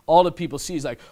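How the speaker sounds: normal talking voice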